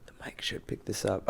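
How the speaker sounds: very soft whispered aside; hushed aside. Real, not acted. Host wondering if the mic picks this up, whispered. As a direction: drop to a near-silent murmur.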